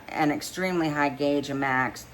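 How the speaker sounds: usual speaking voice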